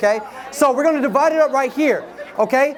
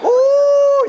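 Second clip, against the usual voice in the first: high shouted road-rage outburst; 7 semitones higher, slower than usual. Retold or imitated. Driver cut off on the highway, shouting in mock rage. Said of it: "cuts you off on the highway".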